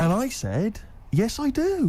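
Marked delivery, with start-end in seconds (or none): 1.1-1.9 jumping at the offer, much higher and laughing; eager yes. Retold or imitated. Flattered bloke accepting stolen speakers, eagerly laughing. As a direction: blurt it out with a laugh, far too keen.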